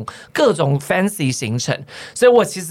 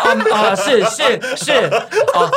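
nervous laughing yes-yes, pitched up; flustered laughing reply; 8 semitones higher, much slower than usual. Retold or imitated. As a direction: fumble the answer through laughter. Re-enacts fawning reply, laughing. (紧张地边笑边应和)

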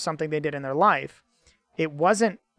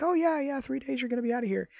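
high, fast and breezy like it's nothing; breezy nonchalant; 6 semitones higher, 6 dB quieter than usual. Retold or imitated. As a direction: toss off terrible news far too cheerfully. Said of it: "how would he deliver it like that?".

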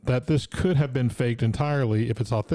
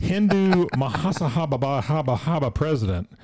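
put-on Indian accent headline; 3 semitones higher than usual. Host reads news headline in a light Indian accent. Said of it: "I don't do an Indian accent".

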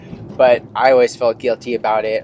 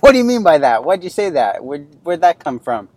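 higher and louder, demanding an answer; retold angry questioning; 5 semitones higher, 6 dB louder than usual. Father retelling how he grilled his son, upset and loud. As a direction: fire the questions at the boy. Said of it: "I just was, like, mad".

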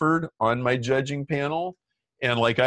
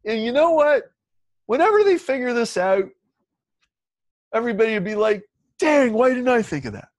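much higher and louder, building enthusiasm; excited investor; 10 semitones higher, 5 dB louder, faster than usual. Retold or imitated. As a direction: hype it up like the investor sharing a big idea. Investor imagining everyone's reaction.

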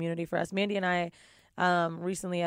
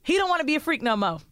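high, quick sassy announcement; sassy Southern-tinged headline read; sassy title read; 7 semitones higher, faster than usual. Acted. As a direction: sell the subject line with sass. Reads the letter subject.

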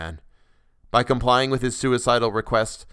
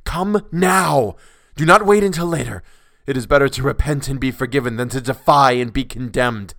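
loud, raised-pitch frantic demand; violent urging; 3 semitones higher, 6 dB louder than usual. A narrator's reading. Madman urges the resisting officer to come, violent loud urging. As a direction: urge with mad, violent force. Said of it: "he grew more violent".

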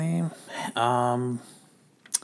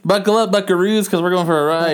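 showman announcer; 5 semitones higher, 3 dB louder than usual. Pretend. Repeats line in bigger voice.

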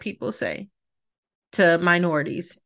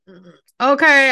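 loud, drawn-out unimpressed okay; 5 semitones higher, 8 dB louder, slower than usual. Pretend. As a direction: let the okay drip with doubt. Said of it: "I would be like".

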